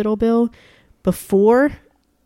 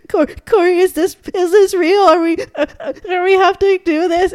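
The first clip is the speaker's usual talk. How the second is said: much higher, louder, voice cracking into crying; sobbing; 11 semitones higher, 6 dB louder than usual. Retold or imitated. Asking Corey through tears if the call is real, sobbing. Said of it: "I was sobbing".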